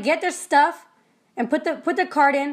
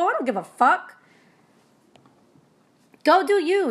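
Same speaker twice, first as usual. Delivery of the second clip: normal talking voice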